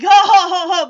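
loud hammed-up ho ho ho; booming imitated belly laugh. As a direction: let out a big mock belly laugh. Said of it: "big old, you know, belly laugh".

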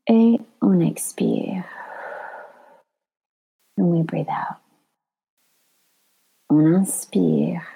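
slow, whispered breathing cues; guided breathing. Acted.

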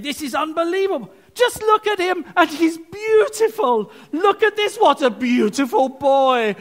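a father swept away watching his son, crying out; gushing proud dad. Retold or imitated. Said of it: "the dad is saying".

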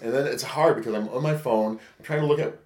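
normal talking voice

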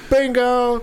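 high, loud excited shout of a winner; high, loud mock bingo call; mock yell. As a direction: yell it out like you just won bingo. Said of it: "somebody yell".

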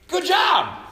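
high exclaimed good job; a shouted pat on the back; excited cheer. Real, not acted. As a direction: cheer them on from the pulpit. Preacher's encouraging exclamation.

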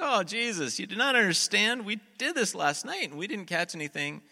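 high, loud, hammed-up complaint; exasperated protest. Tired fishermen argue back at Jesus, exasperated protest. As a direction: protest loudly as if he just doesn't get it.

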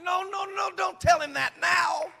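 high, loud, slowing plea to stop; panicked shout. Retold or imitated. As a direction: shout in alarm to stop him. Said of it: "I was like".

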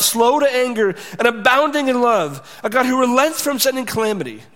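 high, loud, indignant complaint to God; Jonah's angry prayer. Acted. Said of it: "prayed to the Lord".

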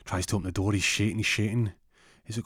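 ordinary talk

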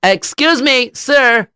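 high, polite call; calling to a stranger. Acted. Rabbi stops a passer-by in Italy, calling out. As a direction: pitch it up to catch his attention.

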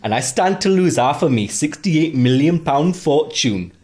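hammy wandering Geordie voice; Geordie accent. Acted. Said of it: "should I do it in a Geordie accent?".

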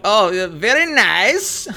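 put-on slow, high Borat accent. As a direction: play it like Borat. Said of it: "Ron's like".

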